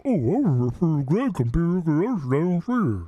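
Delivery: lightly voiced mimic of Phil's excuse; a hint of Phil, explaining himself; Phil impression. Teasing a stream viewer with a light Phil voice. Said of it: "That's my Phil impression".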